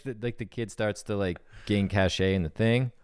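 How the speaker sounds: ordinary delivery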